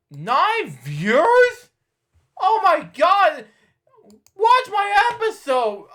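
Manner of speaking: high, loud disbelief; shocked outcry. Real, not acted. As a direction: cry out in dismay at the number. Shocked at low view count.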